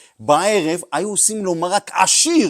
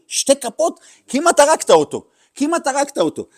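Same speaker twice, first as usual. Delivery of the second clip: alarmed scolding; 10 semitones higher, 6 dB louder than usual. Retold or imitated. The rebbe scolds over two spoons.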